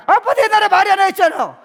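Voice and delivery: put-on high, loud, laughing sassy kid; child talking back. Child retorting to a nagging father, loud and high. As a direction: fire it back like a cheeky kid.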